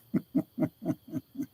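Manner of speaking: soft, high wheezy giggle. Real, not acted. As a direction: giggle quietly in little bursts. Laughing after joking with chatter.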